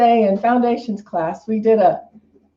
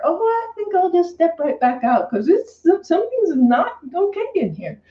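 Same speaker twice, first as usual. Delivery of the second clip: uneasy inner voice; 8 semitones higher than usual. Pretend. Voicing an uneasy inner knowing.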